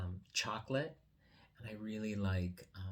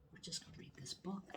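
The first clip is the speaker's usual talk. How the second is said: soft whisper; 8 semitones higher, 10 dB quieter, faster than usual. Real, not acted. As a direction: whisper it gently so he stays calm. Soothing a shy child nearby.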